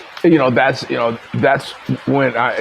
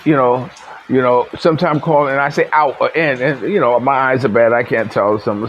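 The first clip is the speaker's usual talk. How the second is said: normal talking voice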